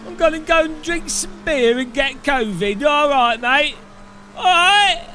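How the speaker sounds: mock a pub-going Brit in an American-style British accent; cliche British accent. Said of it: "Bloody British people are like".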